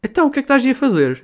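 high, fast amused mother; a mum surprised and a bit amused; lightly voiced mother, high and quick; mum half-laughing. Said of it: "e depois ela".

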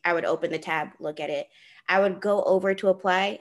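ordinary talk

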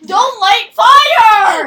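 loud, drawn-out yell of warning; alarmed shout. Real, not acted. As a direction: shout it slowly and forcefully to stop them. Warning someone off lighting fire.

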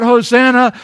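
loud, ringing Hosanna; preacher voicing the crowd's Hosanna; crowd's cry. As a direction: call it out like the crowd. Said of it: "They cry out".

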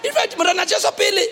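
usual speaking voice

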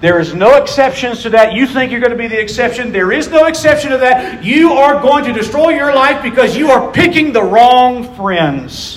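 high, loud, emphatic warning; forceful preaching. Real, not acted. Preacher warns young people about wrong friends, preaching forcefully. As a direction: drive every phrase home from the pulpit.

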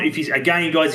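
ordinary delivery

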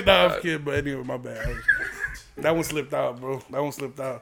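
ordinary talk

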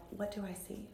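soft, hushed murmur to yourself; hushed self-questioning; whisper to oneself. Retold or imitated. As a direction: ask it very quietly, as if privately. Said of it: "I've started to whisper to myself".

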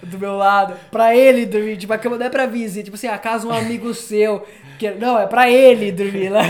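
laughing loud while telling it; cracking up through the story. Real, not acted. Laughing loud about the bed his mom made.

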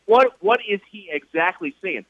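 ordinary talk, nothing marked

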